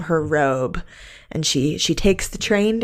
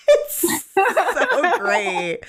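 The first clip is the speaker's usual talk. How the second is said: very slow, laughing it out, pitch up high; delighted, words stretched by laughter; 11 semitones higher, much slower than usual. Real, not acted. Reacting to her cohost's granny line, laughing hard.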